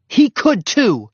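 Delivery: loud, high, slow indignant comeback; hot retort. A narrator's reading. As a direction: defend your dog hotly. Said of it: "said Henry hotly".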